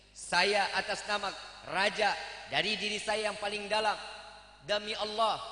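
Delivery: solemn, low royal address; formal eulogy. Acted. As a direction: deliver a dignified funeral speech. King gives funeral speech. (pidato raja yang khidmat)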